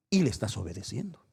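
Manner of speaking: ordinary talk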